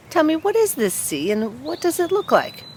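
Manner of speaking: high, eager little tree voice; curious young fir tree. Acted. Young fir tree begging the stork, high and curious.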